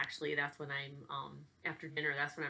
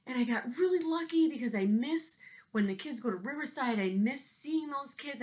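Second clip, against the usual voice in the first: excited; 11 semitones higher than usual. Real, not acted. Excited parade memories.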